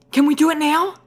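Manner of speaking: high, breathless eager voice. Acted. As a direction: rush to ask, full of hope. Eager follow-up question.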